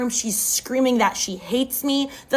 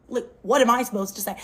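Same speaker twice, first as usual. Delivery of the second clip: plain reading voice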